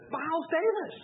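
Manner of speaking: high reverent hush-to-loud jazz fan; awed jazz-lover friend. Retold or imitated. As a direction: pitch way up like a music snob hearing his hero. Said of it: "And he said".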